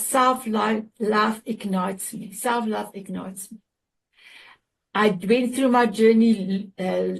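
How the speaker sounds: normal talking voice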